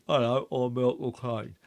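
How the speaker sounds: put-on failed American accent, low and soft; mock American attempt that stays London; Michael Caine. Mocking a one-trick actor, badly faked American accent. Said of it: "Michael Caine doing an American accent".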